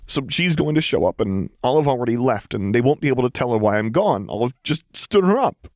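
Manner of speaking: high, distressed rush of worry; rising anguish, pitch climbing at the end; upset. A narrator's reading. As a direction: spill it out, close to tears. Upset Lux building toward tears.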